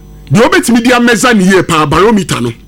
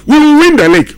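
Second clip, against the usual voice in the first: bold declaration, pitched higher and a touch louder; Luis Enrique confident; 7 semitones higher, 3 dB louder than usual. Retold or imitated. Pundit quoting the PSG coach's league promise, confident and emphatic. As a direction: quote the coach's vow as if the title is already won. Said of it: "He was so, so confident".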